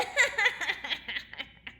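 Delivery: mean giggling laugh; mocking laugh. A narrator's reading. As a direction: laugh down at her. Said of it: "Her stepsisters laughed".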